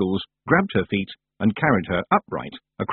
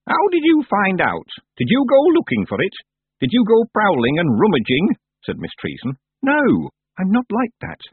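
put-on suspicious old witch; 11 semitones higher, 4 dB louder than usual. Miss Treason interrogating.

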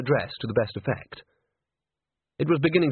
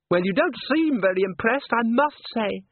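put-on light, higher young woman's voice; Christine's voice; 11 semitones higher than usual. A narrator's reading. Christine speaking to Agnes.

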